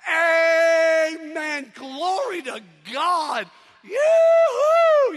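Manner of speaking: loud, long shouts climbing to a high whoop; rowdy crowd member. Acted. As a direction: holler out like the sermon's biggest fan. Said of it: "you can hear somebody in the crowd going".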